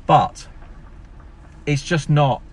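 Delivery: ordinary delivery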